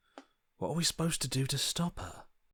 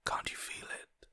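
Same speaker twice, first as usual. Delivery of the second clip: a tempting whisper; soft seductive whisper; 7 dB quieter than usual. Acted. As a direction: coax them under your breath.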